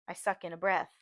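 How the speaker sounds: usual speaking voice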